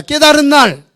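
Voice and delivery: 깨달은 날 loud and clear for the congregation to repeat; phrase for repeating. Shown as a demonstration. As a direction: say 깨달은 날 so they can repeat it. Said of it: "따라합니다".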